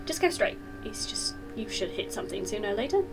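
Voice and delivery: soft hushed whisper. Real, not acted. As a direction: keep it a breathy secret. Whispering scene.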